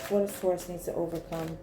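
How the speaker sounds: soft, low murmur; murmured question. Real, not acted. Reader asking the cards about Taurus, murmured. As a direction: ask it quietly, half to yourself.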